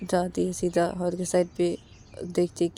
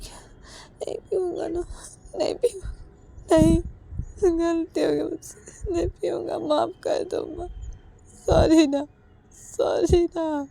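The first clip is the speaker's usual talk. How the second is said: higher, crying whisper breaking into pleas; whimpering plea; 11 semitones higher, 3 dB louder than usual. Acted. Sanju whimpers and cries, begging forgiveness. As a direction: sob out the apology. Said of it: "ऐसे रोते रोते संजू".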